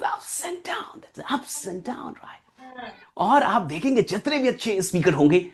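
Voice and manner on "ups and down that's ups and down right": hushed aside. Shown as a demonstration. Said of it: "अगर आपका pitch एक जैसा है".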